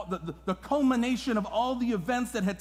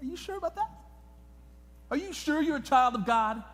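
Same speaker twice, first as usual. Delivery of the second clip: devil's sly doubt; 6 semitones higher than usual. Retold or imitated. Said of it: "He said".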